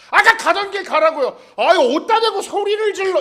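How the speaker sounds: loud, high shouting back; both sides yelling high and loud; shouting quarrel. Pretend. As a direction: pick a fight in the street. Imagined quarrel escalating.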